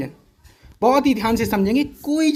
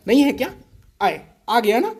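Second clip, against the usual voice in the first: ordinary talk, nothing marked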